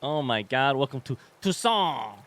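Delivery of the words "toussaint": grandly drawn-out Toussaint; playfully overdone proclamation; hammed-up place name. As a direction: savour the name with mock grandeur. Savours the place name, cracking himself up.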